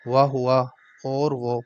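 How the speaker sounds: وہو stretched slowly, Arabic sounds; drawn-out Arabic. Shown as a demonstration. Teacher modelling وہو slowly for students. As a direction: say وہو long and slow. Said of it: "وہو slow".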